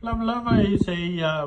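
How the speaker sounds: ordinary delivery, nothing marked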